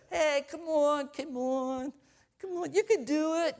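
high coach cheer. Retold or imitated. Coach cheering a player on, high and bright. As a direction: pump them up from the sideline. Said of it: "he doesn't just say".

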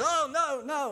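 high, loud alarmed call. Retold or imitated. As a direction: stop her before she cuts in. Attendant stops lady cutting line.

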